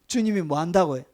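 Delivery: normal talking voice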